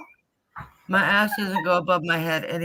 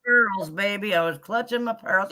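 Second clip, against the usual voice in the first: normal talking voice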